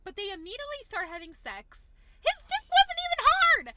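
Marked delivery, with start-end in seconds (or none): 2.2-3.7 high, loud indignant yell; outraged shout. Real, not acted. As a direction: yell it in disbelief. Outraged complaint about scene.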